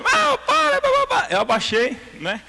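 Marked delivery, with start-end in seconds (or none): 0.0-1.3 high, loud mock scolding teacher; shrill mock teacher. Imitating the teacher who yells at the boy to stop, high and loud. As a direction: squeal it like a flustered teacher telling kids to stop.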